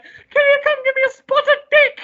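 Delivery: loud, very high falsetto; hammed-up squealing queen role-play; Queen Elizabeth. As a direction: shriek it as a mock British royal. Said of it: "being Queen Elizabeth and Prince Philip".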